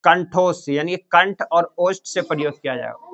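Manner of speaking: ordinary talk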